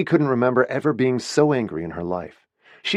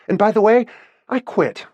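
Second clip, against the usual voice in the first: much higher and louder, ringing out; defiant parting shot; 13 semitones higher, 5 dB louder than usual. Acted. Winnie quitting to her boss's face, loud and defiant. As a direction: throw it at him on the way out.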